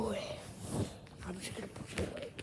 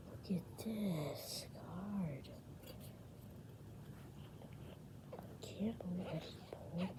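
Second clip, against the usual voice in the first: awed whisper; 10 dB quieter than usual. Real, not acted. Awed over the card.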